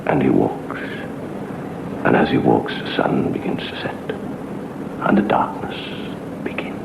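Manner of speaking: slow, hushed ghost-story telling; spooky storytelling. Acted. Storyteller setting a creepy scene, slow and hushed. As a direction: draw the listener into the dark.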